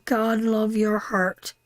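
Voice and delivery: usual speaking voice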